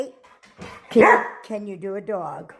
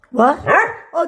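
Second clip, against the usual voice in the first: ordinary talk, nothing marked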